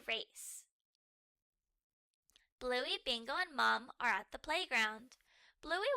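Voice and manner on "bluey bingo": ordinary delivery, nothing marked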